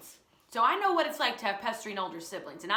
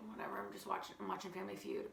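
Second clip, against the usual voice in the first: soft, distracted mumble; absent mumble; 2 semitones lower, 13 dB quieter, faster than usual. Engrossed girl brushing off her brother, softly mumbled. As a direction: brush him off without looking up.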